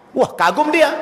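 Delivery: ordinary talk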